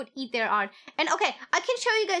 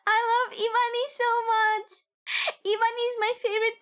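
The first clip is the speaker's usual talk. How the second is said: excited gushing; 8 semitones higher than usual. Real, not acted. Shouting love for bunny.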